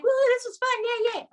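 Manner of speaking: high, gushing fake excitement; mock party cheer. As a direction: squeal it like a flaky party host. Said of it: "one night stand, Like".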